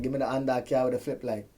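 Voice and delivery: put-on light Jamaican accent, island lilt; a passable Jamaican accent. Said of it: "Okay, that's not bad".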